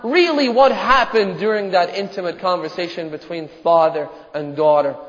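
ordinary delivery

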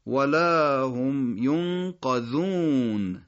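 ینقذون very slowly, long "qa-zuun"; careful recitation demo. Shown as a demonstration. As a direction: draw out ولا هم ینقذون syllable by syllable. (ولا هم ینقذون کشیده و شمرده، با قافِ مفتوح)

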